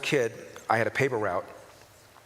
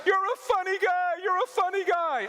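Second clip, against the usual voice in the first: homeless guy voice. Retold or imitated. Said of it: "Homeless guy goes".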